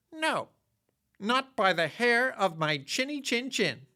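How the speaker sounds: a plucky little pig squeaking back; high, firm pig refusal; squeaky little pig. Acted. Brave third pig refusing.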